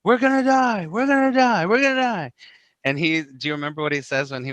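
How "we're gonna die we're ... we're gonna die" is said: high, loud frantic cry of terror; panicked apostles. Retold or imitated. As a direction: scream it like the boat is sinking. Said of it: "And they're like".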